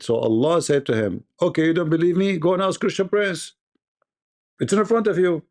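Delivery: normal talking voice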